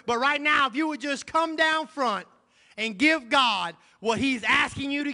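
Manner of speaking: loud, high, driving preacher cadence; preaching at full force. Acted. Pastor rousing the congregation, full-force preaching. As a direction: lift the church with every line.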